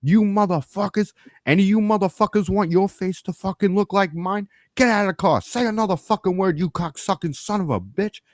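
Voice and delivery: retold screaming at people in a car. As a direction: scream threats at the drivers. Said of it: "And I start screaming".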